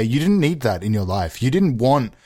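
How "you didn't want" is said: ordinary talk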